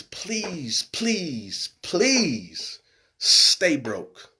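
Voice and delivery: slow, drawn-out pleading; emphatic plea. Real, not acted. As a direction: stretch out every word as you implore them.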